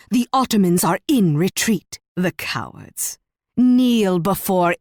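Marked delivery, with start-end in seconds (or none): 2.1-3.2 low, slow, soft scorn; contempt. Acted. Queen scorning the retreating Ottomans, contemptuous. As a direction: drop the voice in disdain.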